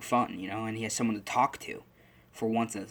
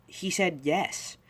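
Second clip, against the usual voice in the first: much higher, slow and wondering; slow, amazed Izuku; 7 semitones higher, much slower than usual. Retold or imitated. Izuku tells the doctors the quirk answered, slow and amazed. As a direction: let the discovery sink in slowly.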